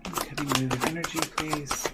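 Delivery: soft, quick repeated chant; murmured invocation. Real, not acted. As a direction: murmur it over and over. Repeating the invocation while shuffling.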